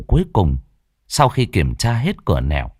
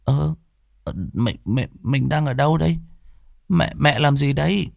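put-on higher, confused stammering boy; dazed boy; 6 semitones higher than usual. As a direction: wake up lost and groping for words. (cậu bé ngơ ngác, lắp bắp giọng cao)

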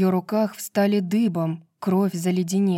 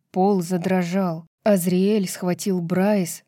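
ordinary delivery, nothing marked

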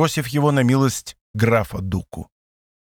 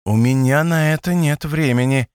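usual speaking voice

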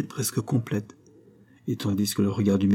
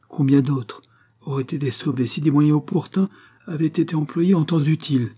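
urgent pleading; 6 semitones higher than usual. A narrator's reading. Woman pleads with the doctor.

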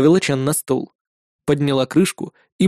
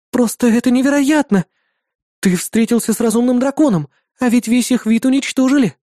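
put-on amazed girl; 9 semitones higher than usual. A narrator's reading. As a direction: marvel aloud like an excited girl. Irda amazed, louder.

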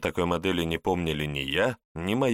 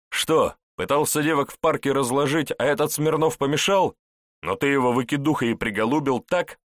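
angry; 7 semitones higher, 4 dB louder than usual. A narrator's reading. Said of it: "Зло оскалился следак".

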